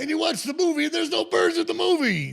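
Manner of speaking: high, loud astonishment about the missing birds; excited disbelief. Real, not acted. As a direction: tell it like a revelation.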